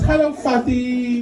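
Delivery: high, slow singsong hello; daughter's greeting. Retold or imitated. Daughter turning to greet her dad, sweet singsong. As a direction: mimic a sweet little girl, stretching the greeting. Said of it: "she turns to me and she goes".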